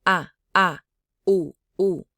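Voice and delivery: "A, a, u, u" cut hard at the throat; glottalized vowels. Shown as a demonstration. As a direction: close the throat on each "A" and "u", loud. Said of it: "Hmong vowels are all glottalized".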